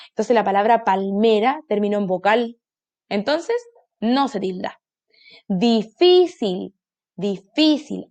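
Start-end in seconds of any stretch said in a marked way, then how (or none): 5.5-8.1 "difícil" stretched slowly, di-FÍ-cil; stressed middle syllable. Shown as a demonstration. Teacher helps children find the tonic syllable, "difícil" with FÍ stressed. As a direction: hold and lean on FÍ in "difícil". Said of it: "Aquí está su sílaba tónica".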